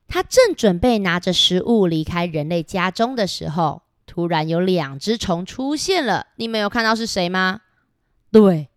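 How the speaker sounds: ordinary delivery, nothing marked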